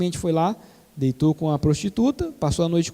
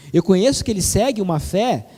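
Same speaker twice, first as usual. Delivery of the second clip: ordinary talk, nothing marked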